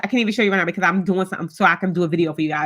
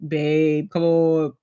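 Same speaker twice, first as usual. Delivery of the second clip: lower, soothing husband voice; husband's voice; 4 semitones lower, much slower than usual. Retold or imitated. Husband soothing his upset wife, lowered coaxing voice. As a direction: coax like her man calming her down. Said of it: "And he be like".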